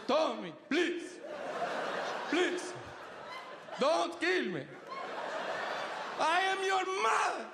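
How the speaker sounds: high-pitched mock begging for life; imitated desperate pleading. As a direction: play a panicked mother begging, building to a wail. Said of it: "She starts to legit plead for her life".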